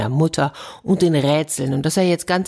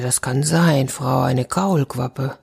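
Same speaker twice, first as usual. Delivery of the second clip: ordinary delivery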